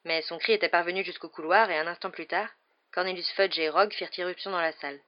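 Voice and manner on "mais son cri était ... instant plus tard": plain reading voice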